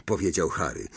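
ordinary delivery

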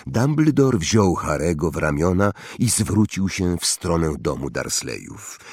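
ordinary delivery

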